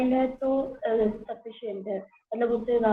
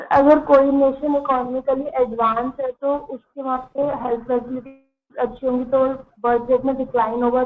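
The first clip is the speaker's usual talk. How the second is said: louder, projected classroom answer; speaking up louder; 5 dB louder than usual. Real, not acted. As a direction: speak up so the teacher can hear. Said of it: "थोड़ा loud बोलो". (ऊँची, साफ़ सुनाई देती आवाज़)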